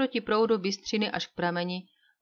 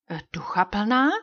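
a mild puzzled echo of the word; surprised; 4 dB louder than usual. A narrator's reading. Banker taken aback by the remark, mild surprise. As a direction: sound taken aback, lightly. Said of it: "podivil se bankéř".

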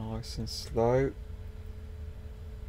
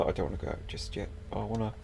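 ordinary talk, nothing marked

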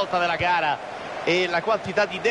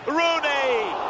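excited call; 7 semitones higher than usual. Real, not acted. Attack build-up commentary.

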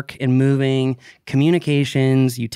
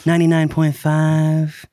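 late night DJ voice; slower than usual. Shown as a demonstration. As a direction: ease it out like a late night radio host. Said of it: "Late night D J voice".

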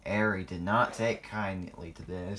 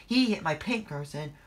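protesting; 7 semitones higher than usual. A narrator's reading. Protest cut off by the boss.

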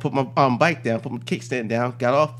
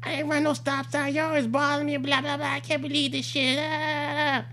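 background yelling; 13 semitones higher than usual. Retold or imitated. Driver yelling while the cop writes her up, mock high ranting. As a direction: imitate her squealed yelling from a distance. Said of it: "still in the background just yelling obscenities".

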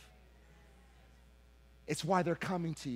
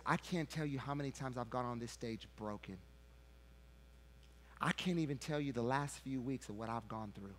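ordinary delivery

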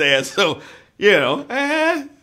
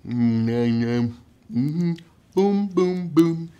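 ordinary delivery

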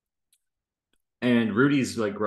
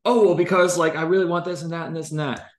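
eager customer voice; 8 semitones higher, 5 dB louder than usual. Retold or imitated. Customer justifying purchase, acted.

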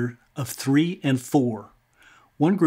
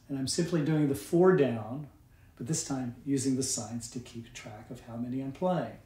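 ordinary talk, nothing marked

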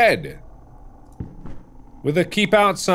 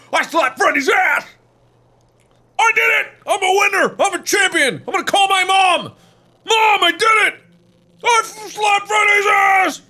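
triumphant shouting. Real, not acted. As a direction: scream it like you just won. Triumphant screaming victory.

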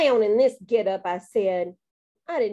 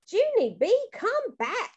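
high-pitched call-back; 11 semitones higher, slower than usual. A narrator's reading. Mother calls child back from the bush, calling. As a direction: sing out the name as if calling. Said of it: "called Mother".

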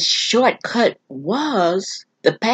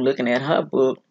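ordinary talk, nothing marked

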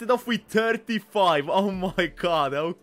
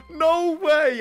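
surprised yelp; 11 semitones higher, 6 dB louder than usual. Real, not acted. Streamer wins a close stake.

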